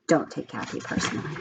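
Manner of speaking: soft quick whispered aside; quiet aside. Real, not acted. Teacher whispers an aside to her class, hushed.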